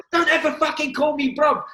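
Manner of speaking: loud, high retold yell; furious yell. Dave screaming at Pat in the dressing room, loud and high. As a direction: roar it at Pat in full fury.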